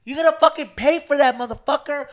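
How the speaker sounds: loud, high-pitched retold threat; mock angry girl. Girl demanding payment for her shot, high mock-furious yell. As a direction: shout it as a hammed-up furious girl. Retold girl's tirade in bar.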